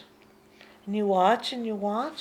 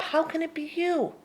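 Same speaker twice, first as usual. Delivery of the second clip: normal talking voice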